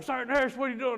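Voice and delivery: loud, high alarmed scolding; scolding shout. Retold or imitated. Staffer catching the runaway sergeant at Burger King, high scolding shout. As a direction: call out a patient caught red-handed.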